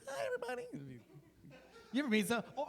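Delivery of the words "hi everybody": tiny, very high squeaky greeting; weird voice. Acted. Comic greeting the crowd as Jesus, weird high voice. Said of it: "You had a weird voice".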